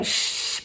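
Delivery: drawn-out unvoiced "shh"; imitated shushing. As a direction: shush the family as if retelling it. Shushing family at table.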